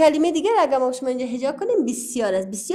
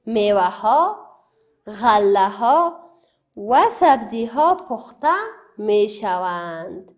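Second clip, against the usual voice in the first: slower and louder, each word spaced out; slow, stressed reading; 3 semitones lower, 4 dB louder, slower than usual. Shown as a demonstration. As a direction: hammer each word home for the pupils. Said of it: "چون". (آهسته و بلند، هر کلمه جدا و با تأکید)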